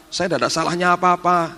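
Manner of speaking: imitating frightened servant. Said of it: "Dia ngomong gini".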